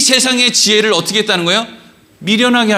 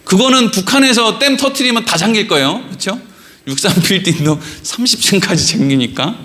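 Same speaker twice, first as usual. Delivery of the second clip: ordinary talk, nothing marked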